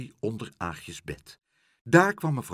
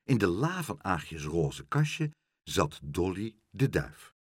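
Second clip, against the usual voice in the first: ordinary delivery, nothing marked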